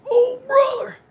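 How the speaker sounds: very high, loud, strained wrestler voice; Hulk Hogan. Acted. Playing along when asked about the impression, wrestler voice. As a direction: push it up into a hammed-up wrestler cry. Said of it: "you doing like Hulk Hogan".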